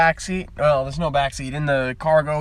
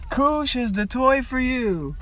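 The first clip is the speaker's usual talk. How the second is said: slow, high-pitched toy ad slogan; commercial mimicry; 8 semitones higher, slower than usual. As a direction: draw out the slogan like a playful TV spot.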